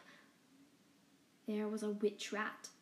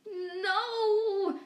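high, loud anguished cry; cat crying out as his friend is slashed; choked cry; 10 semitones higher, 5 dB louder than usual. Acted. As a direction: choke out the word in grief. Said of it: "Spider choked out".

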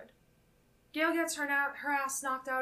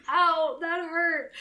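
slow, loud, high whine of hurt; whiny mock pain; 5 semitones higher, 9 dB louder, much slower than usual. Podcaster imitating Stu whining after a phone hits his head. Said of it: "And he's like".

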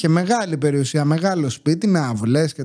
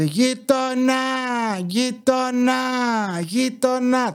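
higher-pitched repeated calls to the neighbor; calling out; 6 semitones higher, much slower than usual. Retold or imitated. Old neighbor calling the narrator from his yard, mildly imitated. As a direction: hail the neighbor over and over, lightly mimicked. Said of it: "Και φώναζε".

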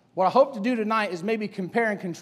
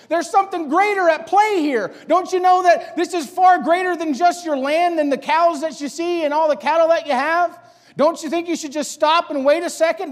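higher and louder, begging him to stop; urgent pleading; 6 semitones higher, 6 dB louder than usual. Acted. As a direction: plead with him to wait, voice rising to a shout. The still small voice pleading urgently.

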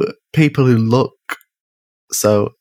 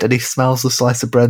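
ordinary talk, nothing marked

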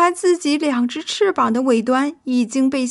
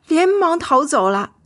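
ordinary delivery

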